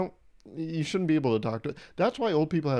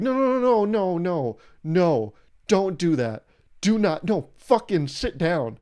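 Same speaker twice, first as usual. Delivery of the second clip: loud, higher rush of no's into a firm order; alarmed scolding; 5 semitones higher, 5 dB louder, slower than usual. Acted. Family stops an elder getting up from the table, alarmed scolding. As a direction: cut them off loudly and order them down.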